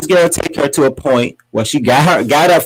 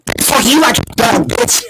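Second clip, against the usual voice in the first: high, hammed-up outburst; squealed mock outrage; Portia imitation; 10 semitones higher than usual. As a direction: mimic an indignant outburst for laughs. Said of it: "you was like".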